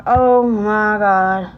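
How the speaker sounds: very slow, stretched-out groaned words; drawn-out dismay. Retold or imitated. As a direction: drag it out in mock agony. Retelling onset of severe pain.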